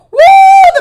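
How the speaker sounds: high loud laugh into a whoop. Real, not acted. Viewer reacting to Lauren's dig, whooping laugh.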